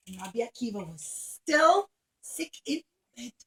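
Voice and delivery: plain reading voice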